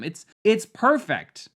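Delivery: high, loud, drawn-out exclamation; worked-up rant peak; exasperated insistence. Real, not acted. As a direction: stretch it out loudly in exasperation.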